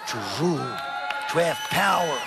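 preaching shout. Acted. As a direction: hammer the word power home.

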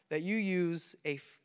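ordinary talk

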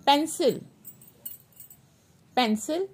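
ordinary talk, nothing marked

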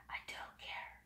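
breathy whispered throwaway; a real whispered "I don't care"; whispered aside. Real, not acted. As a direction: whisper it to yourself, done with it. Muttered aside after cursing.

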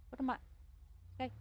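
normal talking voice

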